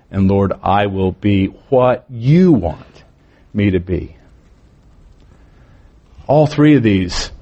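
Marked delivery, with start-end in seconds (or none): 0.1-4.2 slow, measured line swelling onto YOU, pause before me to be; stress on YOU. Shown as a demonstration. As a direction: stretch out and weight you. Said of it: "that's where the emphasis becomes".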